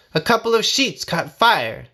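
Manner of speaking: plain reading voice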